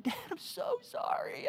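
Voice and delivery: put-on high, strained cry of a scared boy; a frightened kid sobbing out sorry to his dad; crying apology. Boy in the garage cries up to his dad, high and tearful.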